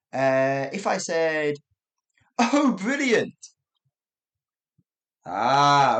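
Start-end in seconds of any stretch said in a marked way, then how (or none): 2.4-3.3 high, loud delighted exclamation; bright rising-then-falling cheer; genuinely pleased. Shown as a demonstration. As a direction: say it as if it's truly great news. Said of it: "That's great" for the first one".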